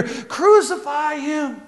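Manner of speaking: a sly voice urging from the back, drawn out; hidden voice in crowd. Retold or imitated. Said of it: "from behind them they hear".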